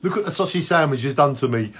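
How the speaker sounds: ordinary talk, nothing marked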